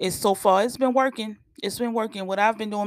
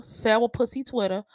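ordinary delivery

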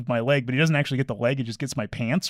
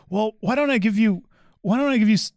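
high-pitched tentative bargaining voice; retold placating offer; 10 semitones higher, 4 dB louder than usual. Dog owner offers to pay for torn pants, high placating voice. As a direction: imitate a man nervously offering a deal.

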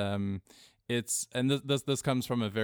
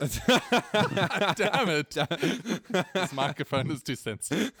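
laughing; 8 semitones higher, 3 dB louder, slower than usual. Real, not acted. Laughing at own slip.

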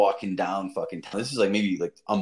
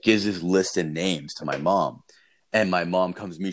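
normal talking voice

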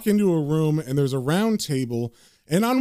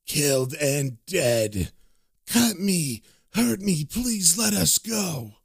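slow, drawn-out undead moan; eerie pleading corpse voice; speaking corpses; much slower than usual. Acted. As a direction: groan it like the reanimated dead. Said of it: "two of them begin to speak".

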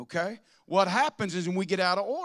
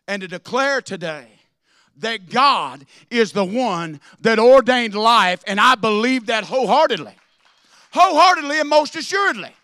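raised, loud preaching voice; preaching at full force; 5 semitones higher, 15 dB louder than usual. Acted. Pastor rails to his church, loud preaching. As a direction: hammer it home to the congregation.

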